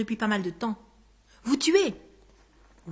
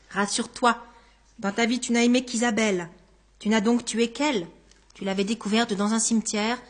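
ordinary delivery

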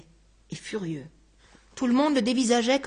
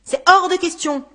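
louder, higher, firm refusal; angry refusal; 7 semitones higher, 8 dB louder than usual. Acted. Man refusing a stranger's familiarity, angry. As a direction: cut him off angrily.

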